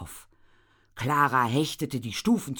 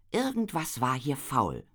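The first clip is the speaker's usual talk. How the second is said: plain reading voice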